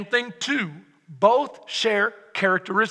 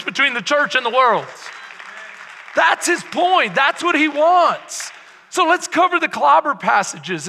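louder, driving preacher's build; full-force preaching; 2 semitones higher, 5 dB louder than usual. Acted. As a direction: press it home to the congregation.

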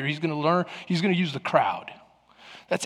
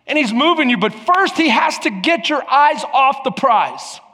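raised, emphatic pulpit delivery; preach; 6 semitones higher, 6 dB louder than usual. Real, not acted. Preacher warning the congregation of the enemy's goal, high forceful preaching. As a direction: build intensity and hammer each point.

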